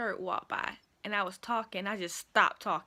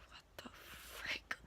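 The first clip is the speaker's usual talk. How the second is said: slow, stunned whisper; shocked whisper; 16 dB quieter, much slower than usual. Retold or imitated. Whispering in shock as the man arrives. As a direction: gasp it out under your breath. Man appears asking for her.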